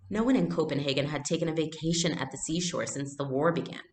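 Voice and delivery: normal talking voice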